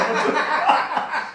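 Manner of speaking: loud breathy burst of laughter; real laugh at the likeness. Real, not acted. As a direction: laugh out loud, caught off guard. Laughing at sister's resemblance.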